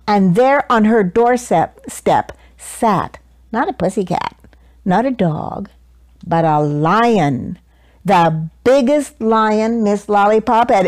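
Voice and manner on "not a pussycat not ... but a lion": usual speaking voice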